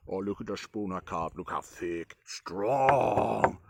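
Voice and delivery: hammed-up boastful carver voice, drawn out on the last word; lightly put-on proud caveman-like boast; mock crude carver. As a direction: play a crude carver admiring his work. Mocks a crude spoon carver.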